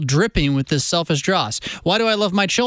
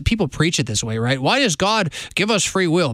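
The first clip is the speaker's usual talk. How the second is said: usual speaking voice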